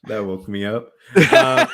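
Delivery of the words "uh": laughing. Real, not acted. Laughing at truck joke.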